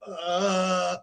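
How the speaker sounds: startled stammer. Retold or imitated. Freaked out reply.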